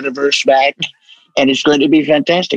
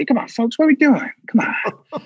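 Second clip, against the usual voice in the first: higher, softer Biden with breathy come on; Biden impression; 9 semitones higher, 4 dB quieter than usual. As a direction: wheeze the come on like an old man. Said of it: "He keeps doing that thing".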